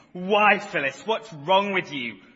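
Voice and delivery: loud, furious screaming; screaming at Phyllis. A narrator's reading. Driver screaming at Phyllis after the knock, furious. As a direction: scream it at her in a rage. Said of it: "you start screaming".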